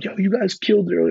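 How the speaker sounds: fast high hyped compliment; hyped praise. Retold or imitated. Bassist praising the band, fast high praise. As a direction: fire it off high and stoked. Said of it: "he was like".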